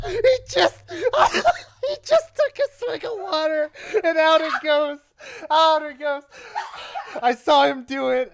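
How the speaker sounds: high, loud, cracking up through the words; helpless laughter. Real, not acted. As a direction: barely get it out for laughing. Friend spits water from laughing.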